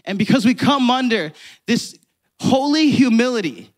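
rising to a loud, fervent preach; preaching climax. Acted.

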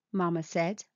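plain reading voice

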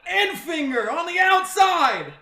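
high loud race call; excited call. Real, not acted. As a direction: call the move like a commentator. Calling the race.

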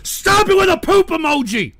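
high-pitched, sputtering anger; exasperated scolding. Real, not acted. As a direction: order them to stop, stumbling in frustration. Scolding chat over emojis.

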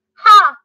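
sharp, high, loud jeering bark of a laugh; one loud taunting laugh from above; blue jay crowing at the kittens; mocking ha. A narrator's reading. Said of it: "cried a voice above".

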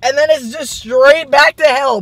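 loud, high, pained; mouth burning from spicy food. Real, not acted. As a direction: complain through the burn. Burning from hot sauce.

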